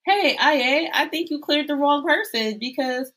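high-pitched, put-upon protest; mock indignant complaint. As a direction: act out an outraged demand for backup. Acted complaint about being yelled at.

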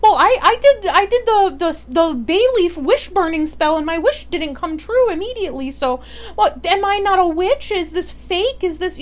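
high, loud whiny complaining; whiny newbie witch. Retold or imitated. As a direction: play a confused beginner whining online. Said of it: "they turn to, like, the online forums".

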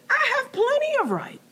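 way up high, a put-on Jonah voice. Preacher voicing Jonah defending his anger, high put-on voice. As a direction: squeak it out high as sulky Jonah. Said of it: "And Jonah says".

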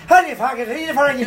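high, garbled Irish greeting; imitated Irishman, words slurred into brogue; thick Irish brogue. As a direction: drop into an unintelligible Irish accent. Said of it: "slipping into the brogue with me".

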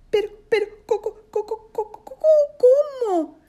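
high-pitched stammer on repeated syllables; stuttering. Acted. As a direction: stutter high in startled disbelief. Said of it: "tartamudeó Matías". (tartamudeo agudo)

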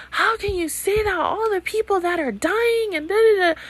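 high-pitched mocking voice, way up; squeaky mock outrage; mocking whiny friend. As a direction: mimic the pearl-clutching friends. Mocking friends' shocked objection.

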